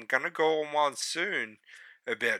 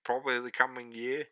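upbeat plug for the channel; promo outro read; 6 dB louder than usual. Acted. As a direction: read the socials like an announcer.

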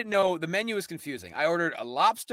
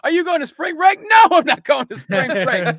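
much higher, louder put-on silly voices; mock riff voice; 12 semitones higher, 9 dB louder than usual. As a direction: blurt it out like a ridiculous character, way up high. Replaying their Arby's riff.

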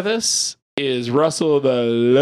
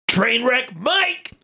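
drawn-out hype intro; 7 semitones higher, slower than usual. Acted.

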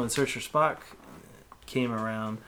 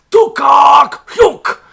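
guttural alien war cry, very loud and high; mock Klingon scream; 20 dB louder, much slower than usual. Imitating a movie Klingon for a co-host, screamed. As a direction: bellow it, drawing out each barked word. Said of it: "out there screaming".

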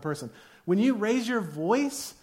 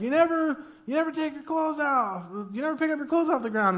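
high, complaining nag; hammed-up spouse complaint; mock nagging spouse; 8 semitones higher than usual. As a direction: pile on the accusations in a high whine.

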